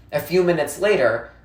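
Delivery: ordinary delivery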